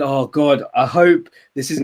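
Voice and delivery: ordinary delivery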